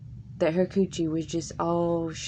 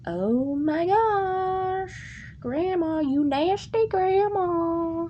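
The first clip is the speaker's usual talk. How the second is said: very high, slow, squealing disgust; grossed out; 11 semitones higher, much slower than usual. Retold or imitated. Kid scolding grandma, high drawn-out disgust. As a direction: stretch it out, totally grossed out. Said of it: "I'm like".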